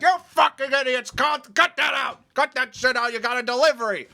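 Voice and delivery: high, loud scolding shout; mock angry yell. Manager breaking up the fight, loud high scolding yell. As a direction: bark at the brawling idiots.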